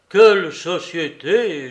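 a touch of a groaning lament; mild moan. Acted. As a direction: moan at the company he keeps. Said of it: "gémit Soupe".

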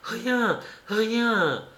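quick-spirited high exclamation; lively, high-pitched denial; vivacious cry. A narrator's reading. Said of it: "s'écria-t-elle avec vivacité".

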